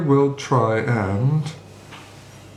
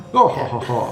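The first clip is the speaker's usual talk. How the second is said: villain laughing it off. Acted. Game master as vampire shrugging off a command spell, booming scorn. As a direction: scoff grandly with a big stagey "ha, ha, ha". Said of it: "he just laughs it off".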